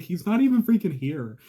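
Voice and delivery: loud, high-pitched disbelief; indignant outburst. Acted. Defendant fuming that the officer is absent, indignant. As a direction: blurt it out loud and high, outraged.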